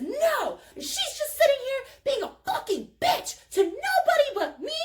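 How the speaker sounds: put-on very high drunken complaint; whiny accusation. Drunk friend complaining to the driver, very high whiny protest. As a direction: imitate a squealing, indignant tattletale. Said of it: "And she says".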